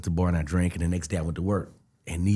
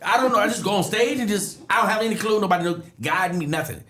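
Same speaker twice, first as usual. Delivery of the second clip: high, laughing panic; high panicked rant; 12 semitones higher, 3 dB louder than usual. Retold or imitated. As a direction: babble it in high alarm. Panicking about first show.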